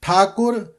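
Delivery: ਠਾਕੁਰ as "thakur", rounded second vowel; short u sounded. Shown as a demonstration. Teacher repeating ਠਾਕੁਰ with the u sounded. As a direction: put a short u after the k in ਠਾਕੁਰ. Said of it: "ਕੱਕੇ ਨੂੰ ਔਂਕੜ ਉਚਾਰਨ ਕਰਨਾ ਹੈ".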